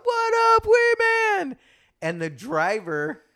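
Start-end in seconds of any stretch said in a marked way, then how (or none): 0.0-1.5 high, loud imitation of an excited kid; retold high-pitched fan yell. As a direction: holler it from a passing car. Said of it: "rolls down the window and yells out".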